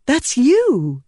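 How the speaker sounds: adopted persona voice. Acted. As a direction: slip into another self, lightly. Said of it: "as she adopted the persona".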